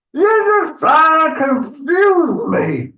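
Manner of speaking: high, drawn-out troll protest; exasperated troll. Acted. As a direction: wail it slowly in frustration. Confused troll protests to Gruff.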